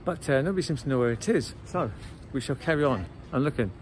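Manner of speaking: ordinary talk, nothing marked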